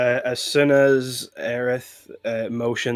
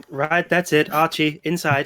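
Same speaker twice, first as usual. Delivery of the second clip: stern order; 6 semitones higher, 5 dB louder than usual. Acted. Player acts sending Archie to jail.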